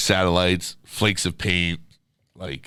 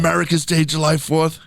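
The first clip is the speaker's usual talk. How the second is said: mock screaming neighbor; 9 semitones higher than usual. Imitating neighbor scolding party hosts, high mock screaming. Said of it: "we just all heard her screaming".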